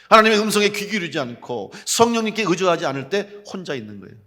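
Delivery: loud, insistent preaching voice; forceful preaching. Real, not acted. Preacher telling congregation what being truly alone means, forceful preaching. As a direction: build the long clauses with loud insistence.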